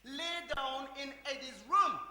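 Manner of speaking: slow, loud, firm order; loud stern order. Acted. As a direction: lay down the law loudly.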